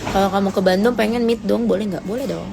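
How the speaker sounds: ordinary talk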